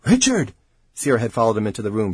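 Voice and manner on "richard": high, urgent call of his name; distressed cry. A narrator's reading. Colleague following him into the room, distressed cry. Weeping woman cries out to him.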